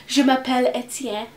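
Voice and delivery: put-on French accent. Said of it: "so French and so posh".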